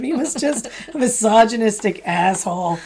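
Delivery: ordinary talk, nothing marked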